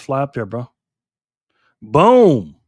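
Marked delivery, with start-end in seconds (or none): 1.8-2.6 louder, a punchy triumphant boom. Real, not acted. Streamer landing the map pin on a viewer's town, loud boom. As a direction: slam the word down like a landing.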